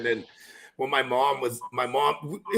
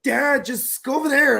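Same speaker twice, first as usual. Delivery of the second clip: embarrassed daughter; 9 semitones higher, 4 dB louder, slower than usual. Retold or imitated. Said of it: "She's like".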